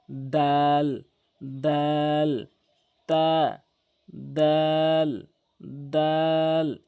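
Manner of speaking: দাল held long and firm, tongue tip at the tooth roots; Arabic dal. Shown as a demonstration. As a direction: stretch দাল slowly, firm d. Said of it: "এভাবে উচ্চারণ করবেন".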